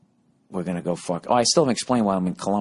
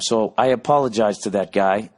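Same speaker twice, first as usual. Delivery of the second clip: ordinary delivery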